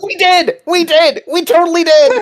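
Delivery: high-pitched, thrilled outburst; excited squeal. Real, not acted. Host confirming to friend they saw the squirrel, excited squeal.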